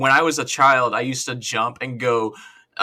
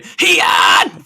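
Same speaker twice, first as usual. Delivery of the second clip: put-on loud battle cry of a young hero; anime hero yell; 4 semitones higher, 10 dB louder than usual. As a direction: shout it like a determined protagonist. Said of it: "give me that sound again".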